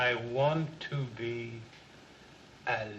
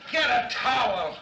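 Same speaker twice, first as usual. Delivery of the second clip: loud gasping demand; 7 semitones higher, 5 dB louder than usual. Acted. Gasping after salts.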